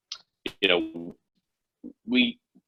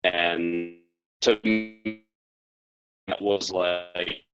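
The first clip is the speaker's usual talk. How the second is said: glitching audio; much slower than usual. Real, not acted. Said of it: "You're breaking up quite a bit".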